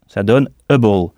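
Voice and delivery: ordinary delivery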